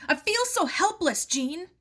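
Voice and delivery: anguished shout, pitched high; a father cries out in helpless rage; the uncle shouts his helplessness at his wife, a furious outcry. A narrator's reading. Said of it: "struck his wooden leg furiously".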